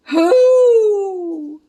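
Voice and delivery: a spooky owl-like call; ghostly hoot. Acted. Ghostly cry fills the air.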